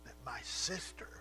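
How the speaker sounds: a sister's put-upon, needling complaint; condescending. Acted. Martha complaining to Jesus, condescending and reproachful. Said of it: "condescending tone in her voice".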